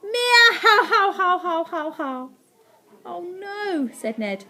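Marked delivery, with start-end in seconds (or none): 0.0-2.3 high, loud meowing laugh, fading away; mocking cat cackle in falling bursts. A narrator's reading. Cats laugh at the pitiful growl, meowing cackle. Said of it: "they actually laughed". 3.0-3.9 high, acted oh-no; a touch of dread, pitched up; dismay. A narrator's reading. Boy sees the cats are unafraid, dismayed. Ned fears laughing cats.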